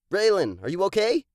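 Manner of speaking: loud, high anxious call to a fallen friend; worried shout. Acted. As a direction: call out to check he's hurt. Friend just fell at bank.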